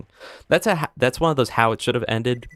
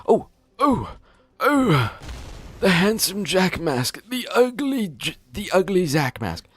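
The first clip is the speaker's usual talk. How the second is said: high excited gamer squeal; 11 semitones higher than usual. Real, not acted. Streamer spotting a mask in game, high excited squeal. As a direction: squeal over the loot find.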